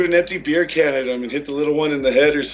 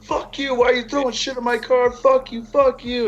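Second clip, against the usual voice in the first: imitating a screaming woman; 8 semitones higher than usual. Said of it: "fucking yelling at all of us".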